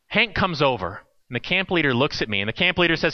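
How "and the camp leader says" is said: usual speaking voice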